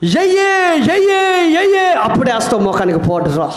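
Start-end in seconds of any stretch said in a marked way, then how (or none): 0.1-1.9 mock calling out. Mock nagging to powder.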